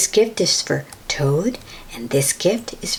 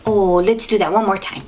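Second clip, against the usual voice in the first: plain reading voice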